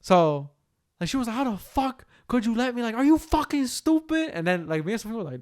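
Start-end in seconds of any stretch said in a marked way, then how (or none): 1.3-4.3 high-pitched imitation of a furious woman; retold shriek, climbing in pitch but contained; mock outrage. Retelling girlfriend berating boyfriend, mock outrage.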